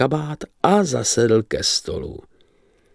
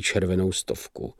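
melancholy; 3 semitones lower, 8 dB quieter than usual. A narrator's reading. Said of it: "hlásil melancholicky".

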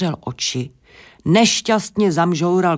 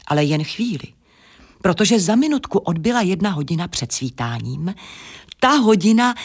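ordinary delivery

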